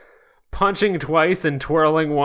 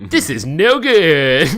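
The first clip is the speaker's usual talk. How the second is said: slow, louder hero moan; Sonic line, louder; 6 dB louder than usual. Acted. Sonic at the bar, mildly dismayed. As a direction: drag it out, a little loud.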